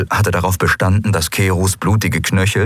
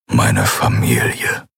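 very low, slow, softened words; the gruff warrior finally says it gently; Keru, tender; 9 semitones lower, slower than usual. A narrator's reading. Keru names Endriel's crew his family, very low and slow.

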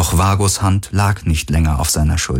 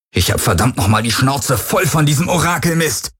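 higher, furious outburst; roaring; 6 semitones higher than usual. A narrator's reading. Garland yelling at the Helix, in a furious roar. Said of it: "brüllte er die Helix an".

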